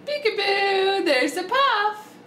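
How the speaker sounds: slow, high, drawn-out peekaboo; playful peekaboo. Real, not acted. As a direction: reveal the puff like a game. Playing peekaboo with baby.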